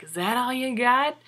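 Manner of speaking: egging the bigger guy on; taunting. Retold or imitated. Beaten man taunting his attacker outside a bar, mock taunt.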